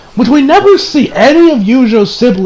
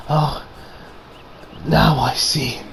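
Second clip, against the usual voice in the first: low, soft acted quote; hushed, with a long pause after oh; quiet realization; 5 semitones lower, 10 dB quieter, slower than usual. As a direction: voice it low and subdued. Voices Kirito's quiet realization.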